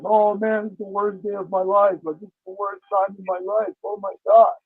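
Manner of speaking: normal talking voice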